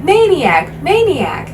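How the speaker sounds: put-on high, loud little kids' squeal. A narrator's reading. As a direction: squeal his name like delighted small children. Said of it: "two familiar voices squealing".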